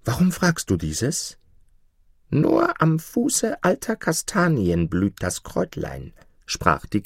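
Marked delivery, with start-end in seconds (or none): 0.0-1.4 higher, hopeful dwarf voice; lighter-hearted. A narrator's reading. Dwarf tells the goose about the trees, lighter-hearted. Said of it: "erwiderte Nase mit leichterem Herzen". 2.3-6.1 high-pitched storybook goose; knowing goose. A narrator's reading. Goose explains where the herb blooms, knowing.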